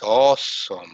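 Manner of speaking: low, drawn "Awesome" in wonder; amazed awesome. Shown as a demonstration. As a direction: say "Awesome" like you're struck. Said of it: "something like this".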